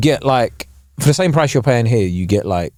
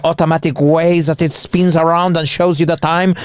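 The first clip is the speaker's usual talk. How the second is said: snooty watch expert; 3 semitones higher than usual. Retold or imitated. Watch-shop staff scold an Apple Watch wearer, mock-offended. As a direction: lecture as an affronted watch snob, stretched out and rising. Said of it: "they look at you like a dickhead. They're like".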